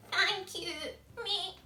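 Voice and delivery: high bleating thanks; goat voice. Acted. Goat thanks George for the sail, high and bleating. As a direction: bleat a happy thank you.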